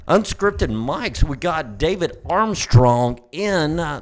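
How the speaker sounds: slow, drawn-out show host intro; announcer intro. Acted. Podcast opening intro.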